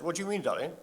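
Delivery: put-on soft, gentle question to a little boy; tender to grandchild. Grandfather gently asking his small grandson about flags. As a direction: hush it down, coaxing a small child.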